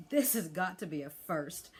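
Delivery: lazy, dry, stretched-out remark; drawled. A narrator's reading. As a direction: savour the sarcasm. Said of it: "drawled Fish Legs".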